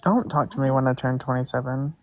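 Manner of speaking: usual speaking voice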